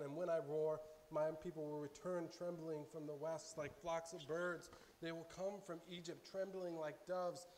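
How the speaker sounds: loud, fervent reading of God's words; impassioned scripture reading. Acted. Preacher reading God's plea to Israel, loud and fervent. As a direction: proclaim it like the Lord pleading. Reads Hosea aloud with passion.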